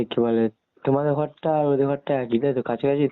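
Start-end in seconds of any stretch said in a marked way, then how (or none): none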